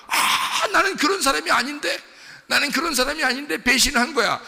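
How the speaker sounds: high, loud wail of self-reproach; anguished outcry. Acted. Preacher voicing Judas after the betrayal, anguished outcry. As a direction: cry out in horrified disbelief at yourself.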